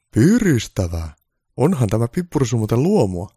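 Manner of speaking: cheerful Santa. Acted. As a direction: savour the pepper spray happily. Santa enjoys the spray.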